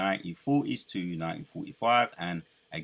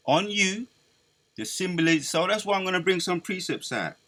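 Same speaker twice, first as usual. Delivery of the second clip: higher, raised preacher's warning; preaching; 8 semitones higher, 4 dB louder than usual. Acted. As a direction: proclaim the omen like a prophet.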